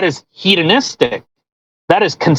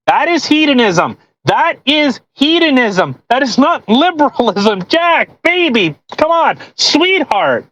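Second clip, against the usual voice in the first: higher, exasperated insistence, almost begging; exasperated pleading; 7 semitones higher than usual. Real, not acted. Reader begging author Jack to see the difference, exasperated high pleading. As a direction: plead with him like he just won't get it.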